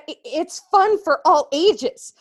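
ordinary delivery

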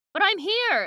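high, loud objection; insistent protest. Acted. Retelling a character told he is dead, insistent protest. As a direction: protest it in disbelief, pitch jumping up. Acted argument from the book.

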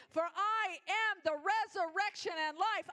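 loud and high on "am", proclaiming; proclaiming shout. Acted. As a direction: shout it out as the peak of the sermon. Climax of the sermon.

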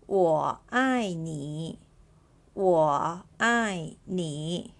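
我爱你 said very slowly, each syllable apart; slow model sentence. Shown as a demonstration. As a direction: spell it out slowly for beginners. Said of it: "The sentence is".

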